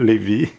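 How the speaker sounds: Levi said the French way, lih-VEE, with no final eye sound; French pronunciation. Shown as a demonstration. Showing viewers how she says the creator's name in French, lih-VEE. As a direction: slip into the French Levi, short i then long ee. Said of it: "because of my French accent".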